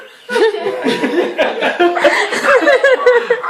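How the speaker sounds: high, loud burst of laughter. Real, not acted. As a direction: break into a laugh. Laughing at his own story.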